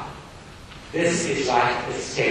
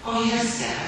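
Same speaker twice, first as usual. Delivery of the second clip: timid schoolgirl; 11 semitones higher than usual. Acted. Sissy voiced in reading.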